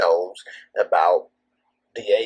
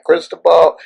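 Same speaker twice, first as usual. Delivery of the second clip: incredulous; 9 semitones higher, 7 dB louder than usual. Retold or imitated. Disbelief at Cristobal accusation.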